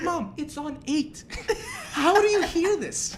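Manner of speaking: high, drawn-out disbelief with laughter; incredulous protest. Retold or imitated. Son to mom about TV on eight, incredulous.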